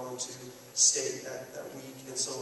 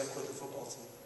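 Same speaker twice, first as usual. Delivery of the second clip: much softer, subdued confession; retold tearful confession; 9 dB quieter than usual. Boy confesses to speaker, retold on the verge of tears. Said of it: "he, like, starts to cry".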